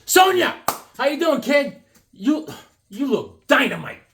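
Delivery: schmoozing host. Pretend. Greeting the Sonia figure from the package, cheesy schmoozing. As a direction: flatter her like a cheesy uncle.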